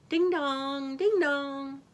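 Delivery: high chiming ding-dong; doorbell imitation. A narrator's reading. Wolf rings the cottage bell, high chiming voice. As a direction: sing out a doorbell chime. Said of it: "he rang the bell".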